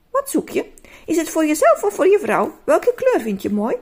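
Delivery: high, bubbly shop-lady chatter; cheerfully serve a customer; cheerful and sweet. Acted. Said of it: "Ze sprak heel vrolijk en lief".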